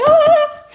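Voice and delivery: very high loud squeal; excited shriek. Retold or imitated. Vlogger reliving her thrill, a high loud squeal. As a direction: shriek with delight. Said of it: "And I was like".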